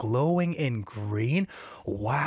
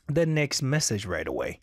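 normal talking voice

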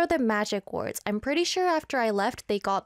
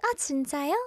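higher, cutesy Korean voice; 4 semitones higher, much faster than usual. Shown as a demonstration. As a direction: react in a cute, raised voice. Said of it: "I speak in a more cute voice".

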